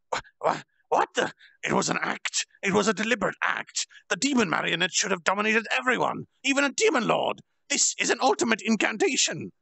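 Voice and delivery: villain realizing he was fooled; stunned disbelief. Acted. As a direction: sputter as the trick sinks in. Clayman stunned by the reveal.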